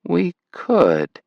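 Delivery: musing, considering the idea; thoughtful. A narrator's reading. Grandmother considering her grandson's idea, thoughtful. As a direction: weigh it slowly in your mind. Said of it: "she said thoughtfully".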